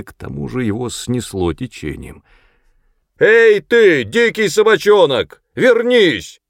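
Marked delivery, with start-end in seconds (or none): 3.2-6.4 high, loud shout across water; commanding shout. A narrator's reading. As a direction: yell after someone getting away. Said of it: "крикнул сержант Коллинз". (громкий приказной окрик издалека)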